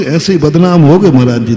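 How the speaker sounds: ordinary talk, nothing marked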